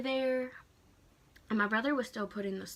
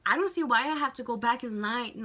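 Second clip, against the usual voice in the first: louder, mildly indignant imitation; retold indignant complaint; 2 semitones higher, 7 dB louder than usual. Rude man grumbling about going back in line, mock indignant.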